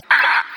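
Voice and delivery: short, loud parrot squawk. Acted. Storyteller imitating a pet store parrot, loud squawk. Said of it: "That's a parrot sound".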